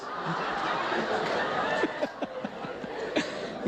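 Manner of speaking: real unvoiced laugh after the punchline; amused real laughter; long laugh. Real, not acted. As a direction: let the laugh run out. Laughing at his own punchline.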